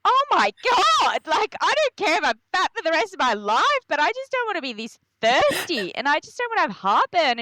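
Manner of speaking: hammed-up wailing complaint; mock despair. Complaining about pregnancy heartburn and thirst, high and wailing.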